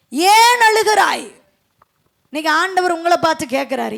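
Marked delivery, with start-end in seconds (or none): 0.1-1.3 slow, raised-pitch question, lightly acted; angels' question. Angels asking weeping Mary at the tomb, slow lifted question. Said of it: "அங்க தூதர்கள் கேட்கிறாங்க மரியாளை பார்த்து".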